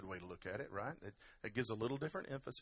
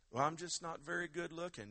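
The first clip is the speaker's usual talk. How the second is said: higher-pitched self-doubting voice; lightly voiced complainer, pitched up; mock self-pity; 7 semitones higher than usual. Preacher voices someone doubting their looks, mock self-pity.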